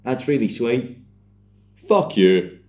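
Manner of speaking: mild mock shout, rising in force; retold shout. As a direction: act out her shouted retort, half-hearted. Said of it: "And then she shouts".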